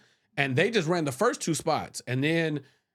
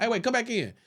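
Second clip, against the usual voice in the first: quick higher-pitched call-back; calling him back; 7 semitones higher than usual. Retold or imitated. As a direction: call someone back into the room. Casting man calling him back.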